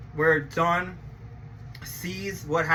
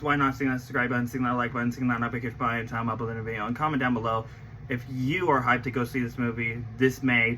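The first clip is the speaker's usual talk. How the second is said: outro pitch; 8 semitones lower, faster than usual. Acted. Subscribe outro read.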